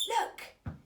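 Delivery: high, loud breathy shout; a boy's sudden high shout; excited cry. A narrator's reading. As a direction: call out sharply as you spot him. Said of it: "Peter cried".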